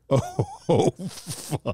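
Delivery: high-pitched oh, then hushed curse; high oh collapsing into a voiceless swear; dismayed reaction. Retold or imitated. Imitating the director on seeing real people, dismayed.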